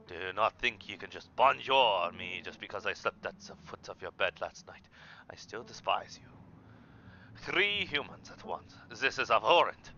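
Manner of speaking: put-on disdainful French-accented cat; haughty complaints in a put-on French accent. Cat despising the humans in its kitchen, French accent. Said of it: "I was doing a French accent".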